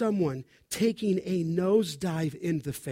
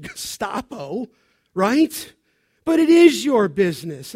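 normal talking voice

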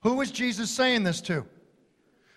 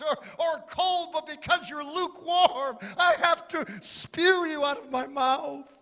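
impassioned rebuke climbing in pitch; preacher's high, urgent plea, building phrase by phrase; Jesus; 8 semitones higher than usual. Acted. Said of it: "Jesus has to say".

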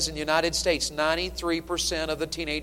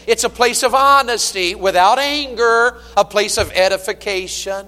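loud, high, ringing pulpit delivery; full-force preaching; 5 semitones higher, 9 dB louder than usual. Acted. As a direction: preach it out to the whole church.

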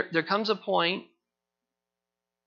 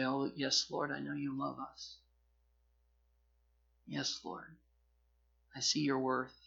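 much softer, devout and tender; hushed worship; 3 semitones lower, 8 dB quieter than usual. Retold or imitated. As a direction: breathe it like a quiet prayer. Believer's humble worship voiced.

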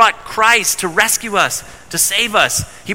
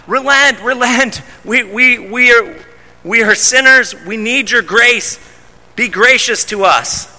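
raised, earnest plea for mercy; pleading; 5 semitones higher than usual. Retold or imitated. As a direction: beg God to relent, voice lifted. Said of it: "fasting and pleading with God".

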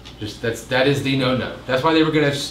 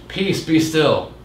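calm command; 5 semitones higher, 3 dB louder, much slower than usual. Retold or imitated. Jesus calms the storm.

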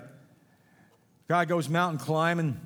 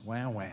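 mock crying; 6 semitones lower, 6 dB quieter than usual. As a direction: fake baby whimper "wah, wah", quieter and lower. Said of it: "So you have a pity party".

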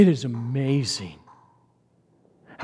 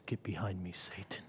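whispered rebuke; 8 semitones lower, 20 dB quieter than usual. Acted. Jesus rebuking Peter.

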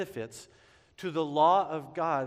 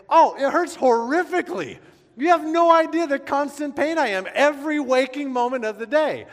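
much higher, much louder melodramatic wail; hammed-up agony; 12 semitones higher, 12 dB louder than usual. Telling girlfriend's mom about his pain, melodramatic wail. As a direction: overact the pain shamelessly. Hammed-up suffering to mother-in-law.

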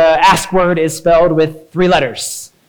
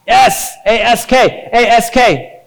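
A S K, slow, loud letter by letter; spelled out; 6 semitones higher, 4 dB louder, much slower than usual. Shown as a demonstration. Preacher spells A S K for the little ones, slow and loud. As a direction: call out A S K slowly, one letter at a time. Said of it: "that spells ask".

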